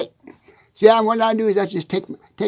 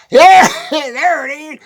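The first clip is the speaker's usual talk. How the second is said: laughing shout of discovery, slower; excited laughing find; 5 semitones higher, 5 dB louder, slower than usual. Real, not acted. Farmer laughs out loud on spotting his key. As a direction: cry out with glee at finding it.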